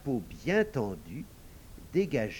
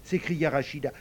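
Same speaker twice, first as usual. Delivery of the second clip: usual speaking voice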